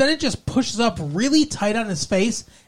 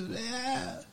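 mock whimper; 4 semitones higher, 16 dB quieter than usual. Voicing crying Donna.

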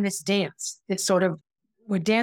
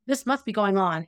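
normal talking voice

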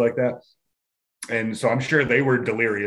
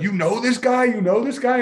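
high, urgent repeated question; frightened demand; 12 semitones higher than usual. Retold or imitated. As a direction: press him in alarm. Frightened boyfriend demanding answers.

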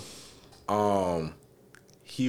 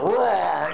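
loud, higher imitation of a roar; mock growl roar; 9 semitones higher, 7 dB louder than usual. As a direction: let out a silly growl. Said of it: "The way he go".

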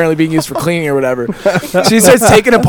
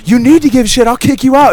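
mock someone spouting off, raised voice; retold scolding; 6 semitones higher than usual. Bartender threatening to kick girl out, retold scolding. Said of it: "the pregnant bartender just spouts off".

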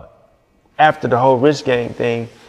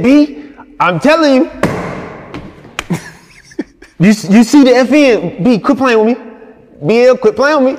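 high, loud mock protest; exasperated [name]; 10 semitones higher, 4 dB louder than usual. [name] protesting in front of the whole crew. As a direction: [name] pleading loudly, half laughing.

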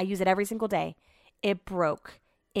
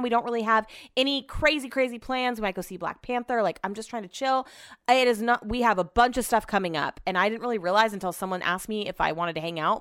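normal talking voice

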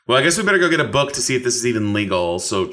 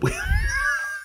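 shrieking laugh. Real, not acted. High shrieking laugh after own idea.

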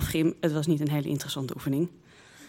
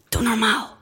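forceful hiss, pitched up; harsh, secretive telling-off; hissed scolding; 4 semitones higher, 3 dB louder than usual. Acted. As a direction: snap it through gritted teeth. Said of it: "siste ik tegen Bibi".

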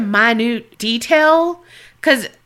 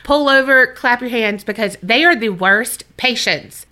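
ordinary delivery

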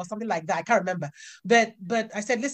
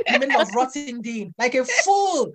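ordinary talk, nothing marked

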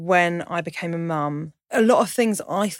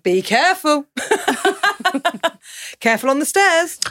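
imitating toddler to cat; 9 semitones higher than usual. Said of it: "she's like".

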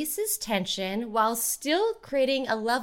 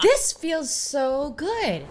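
relieved; 5 semitones higher, 5 dB louder, much slower than usual. Acted. Enjoying stretching pose.

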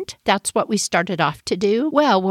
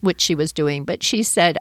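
usual speaking voice

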